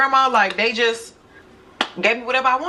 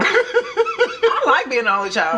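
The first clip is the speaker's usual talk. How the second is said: laughing through the words, pitched up; slow, giggling admission of liking it; 9 semitones higher, much slower than usual. Real, not acted. Laughing about being only child.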